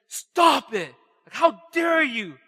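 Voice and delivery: loud, slow, indignant outburst; roaring irritation. Retold or imitated. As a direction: drag out the outrage word by word. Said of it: "It was like a roaring irritation".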